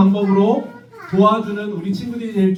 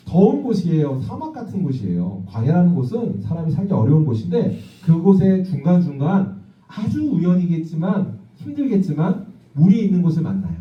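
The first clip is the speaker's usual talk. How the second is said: usual speaking voice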